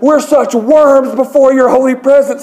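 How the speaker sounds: high, loud, overblown praying; mock yelling. Professor praying grandly before his class, mock yelling. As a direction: cry it out like an overwrought prayer leader. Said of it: "He starts yelling".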